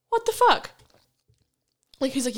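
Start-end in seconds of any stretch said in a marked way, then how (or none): 0.1-0.7 high, squeaked disbelief; mock outrage, pitched way up; stunned disbelief. Recalling the maintenance guy drilling her lock, high stunned squeak.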